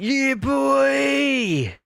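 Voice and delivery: drawn-out high Cockney holler; Cockney geezer impression. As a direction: stretch it out like a little Cockney geezer. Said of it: "In his little Cockney geezer voice".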